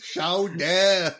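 loud mock-French "Chowder", open last vowel; mocked pronunciation. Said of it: "say it right".

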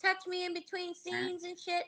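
high, mocking voice of a fussy girl. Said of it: "They're like".

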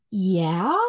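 "Yeah?" with a rising question tune; imitating a child's unsure "Yeah?", pitch sliding up. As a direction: say "Yeah?" as if asking. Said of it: "she always says it like a question".

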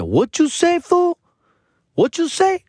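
very high-pitched challenging question; mock challenge in a high put-on voice; imitated confrontation. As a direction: act out an offended stranger, voice shooting way up. Stranger confronts him in retold story.

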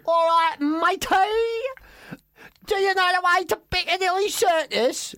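high-pitched, badly faked English accent; terrible English accent. French lad walking around asking directions, terrible English accent. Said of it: "That's the worst English accent".

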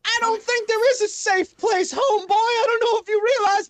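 high, rattled street-tough voice; high-pitched panicky rogue. Acted. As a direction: squeak it out, scared and defiant.

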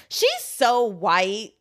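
slow, mocking Caribbean accent of a little girl. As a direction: drag out the words with a Caribbean lilt. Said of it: "but in the Caribbean accent".

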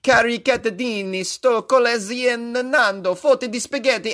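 put-on high-pitched, theatrical Italian accent; over-the-top fake-fluent Italian; hammy Italian. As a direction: read Italian with bluffing gusto. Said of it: "Here's [name] saying some Italian".

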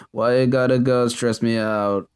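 a lightly voiced zonked drawl; imitating a sleepy, unbothered singer; mild mock calm; unstressed. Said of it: "sounding completely unstressed out".

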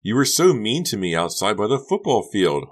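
high, loud, hurt complaint; tearful exclamation. A narrator's reading. Honey crying as she accuses Becca, tearful exclamation. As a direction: exclaim it, wounded. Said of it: "Honey exclaimed".

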